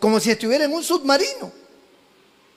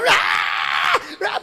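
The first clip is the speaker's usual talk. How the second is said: mock baby wail. Imitating a crying baby.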